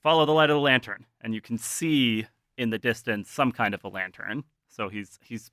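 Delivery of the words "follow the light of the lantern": louder, higher, fast urgent order. Acted. Shotgun-carrying guide pointing the band onward, urgent command. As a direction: call out a quick direction. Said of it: "And he's like".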